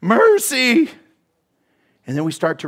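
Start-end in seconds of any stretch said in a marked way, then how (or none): 0.0-0.9 high, loud desperate plea; cry of mercy. Acted. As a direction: throw up your hands and cry mercy. Acted cry of surrender.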